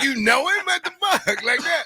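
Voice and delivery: mock-stunned what the fuck; shocked disbelief. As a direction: react in total disbelief. Retold shock at meeting wife.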